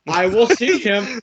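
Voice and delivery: high, loud, slow feeble king; loud old king voice. Acted. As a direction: bellow it like a doddering old king. Repeats old king impression louder.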